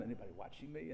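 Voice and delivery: soft, sneaky glance-around question; furtive whisper. Retold or imitated. A man in a corner, quietly checking for watchers. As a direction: hush it like someone about to sin.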